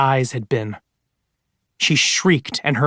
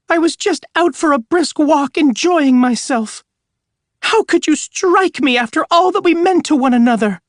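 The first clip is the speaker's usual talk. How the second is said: much higher, hurt ghost-hog voice; 13 semitones higher, 3 dB louder than usual. Acted. Ghost of Anne as a hog scolds Arthur, high voice. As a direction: squeak it out like a wounded sow. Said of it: "have the hog speak in a high voice".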